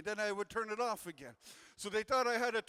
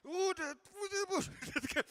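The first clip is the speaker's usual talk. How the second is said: mock strained groan; 9 semitones higher, much slower than usual. Mock prayer groaning struggle.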